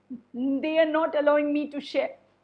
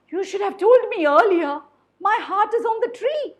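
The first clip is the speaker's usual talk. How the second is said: alarmed cry; 8 semitones higher, 8 dB louder, faster than usual. Acted. Monkey's sudden alarmed realisation.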